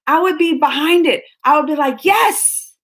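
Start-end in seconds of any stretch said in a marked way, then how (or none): 2.1-2.7 high excited yes; mock cheer, high-pitched. Cheering imagined commercial.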